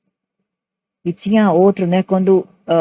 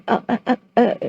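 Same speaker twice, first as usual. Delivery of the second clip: imitated stammer; 6 semitones higher than usual. Shy brother stuck on stage, mock stammering. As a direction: stutter on one sound, imitating a nervous child. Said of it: "ele ficou gaguejando".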